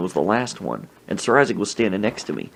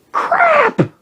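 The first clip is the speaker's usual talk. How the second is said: very high, loud yelp; mock shriek; 5 dB louder than usual. Waitress sneezed on in the face, mock shriek. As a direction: shriek it like you've been sprayed.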